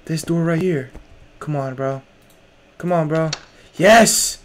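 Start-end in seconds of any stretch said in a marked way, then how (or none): 3.8-4.4 loud, higher burst of relief; real excited exclamation; triumphant. Real, not acted. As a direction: cheer a small win out loud. Finds the right door.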